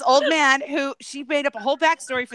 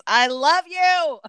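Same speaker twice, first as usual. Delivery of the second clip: drawn-out, higher sing-out; affectionate call-out; 4 semitones higher, much slower than usual. Real, not acted. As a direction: stretch it out slowly to a friend, pitched up. Calling out affection to a chat member.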